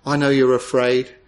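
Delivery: usual speaking voice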